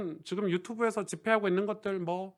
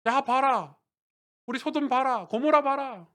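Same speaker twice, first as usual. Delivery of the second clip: raised, louder tempter's call; pitched-up mock boasting; boastful taunt; 8 semitones higher, 5 dB louder, slower than usual. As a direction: call out to show it off, pitched high. Preacher voices a tempting, boastful taunt.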